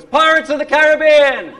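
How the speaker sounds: imitated yell, high and loud; excited schoolkid shout. Actor imitating a pupil guessing the accent, excited shout. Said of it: "And someone goes".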